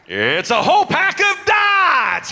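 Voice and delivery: high, loud, slow showman reveal; big excited prize reveal; excited announcement. Real, not acted. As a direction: sell the prize like a game host. Presenting next prize excitedly.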